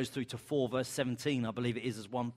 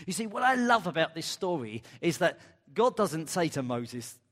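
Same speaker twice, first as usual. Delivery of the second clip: normal talking voice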